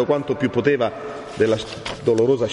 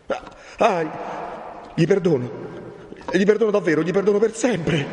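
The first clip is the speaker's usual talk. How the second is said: put-on high, overcome young man; gasp, then pour out forgiveness in a high voice; fervent exclamation; 9 semitones higher than usual. A narrator's reading. Renzo yields to the friar and forgives, exclaiming. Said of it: "esclamò il giovane".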